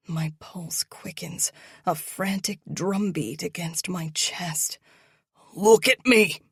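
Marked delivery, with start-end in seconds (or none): 5.5-6.4 loud angry bark. Acted. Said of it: "he barks".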